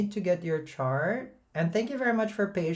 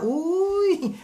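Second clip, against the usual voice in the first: playful exclamation; 5 dB louder than usual. Acted. Playful Filipino exclamation to viewers.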